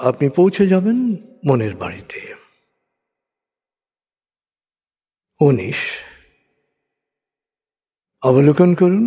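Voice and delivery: slow, soothing hypnotic countdown; guided meditation. Acted. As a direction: lead them down gently, step by step.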